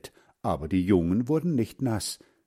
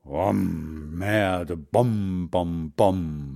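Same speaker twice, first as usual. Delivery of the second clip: low, drawn-out rumbling booms; imitated thunder; 3 semitones lower, much slower than usual. A narrator's reading. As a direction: boom like rolling thunder. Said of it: "machte es am Himmel". (tiefes Donnergrollen nachahmen)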